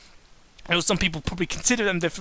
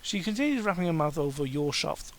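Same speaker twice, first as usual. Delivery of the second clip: ordinary talk, nothing marked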